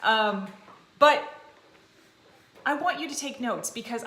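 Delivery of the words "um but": ordinary talk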